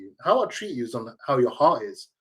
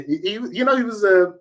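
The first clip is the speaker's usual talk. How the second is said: ordinary talk, nothing marked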